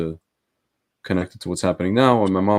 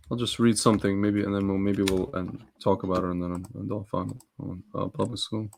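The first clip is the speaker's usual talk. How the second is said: mumbling; 6 dB quieter than usual. Real, not acted. Co-host looking up who started public school, mumbling. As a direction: mumble along while searching for something. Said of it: "You're mumbling, sir. You're mumbling".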